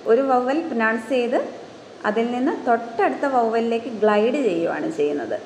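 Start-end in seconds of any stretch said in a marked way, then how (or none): none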